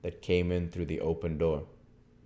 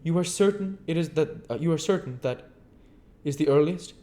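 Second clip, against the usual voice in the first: higher, curt stranger; stranger's voice; 12 semitones higher, 7 dB louder than usual. Acted. Stranger answering Henfrey, higher curt voice. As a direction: play the aloof stranger, pitched up.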